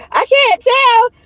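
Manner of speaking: high, slow words squeezed out through laughter; crying with laughter as she reads; laughing while talking. Real, not acted. Reading his texts aloud, high and slow through laughter.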